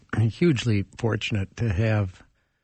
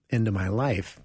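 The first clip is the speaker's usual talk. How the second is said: ordinary talk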